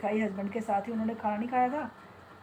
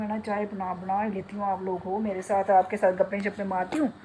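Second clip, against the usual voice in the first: ordinary delivery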